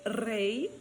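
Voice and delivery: REI with trilled R, ending in an I glide; final Y as I. Shown as a demonstration. Teacher contrasting singular with plural, "rrei". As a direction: roll into rei, end on I. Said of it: "Então, falo".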